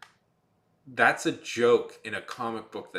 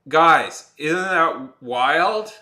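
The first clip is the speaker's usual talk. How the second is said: louder, slow, ironic drawn-out question; mock-sarcastic hype; 4 semitones higher, 9 dB louder, much slower than usual. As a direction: sell a dud punchline, tongue in cheek. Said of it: "I'm pausing so I can let you laugh".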